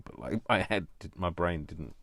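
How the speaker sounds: usual speaking voice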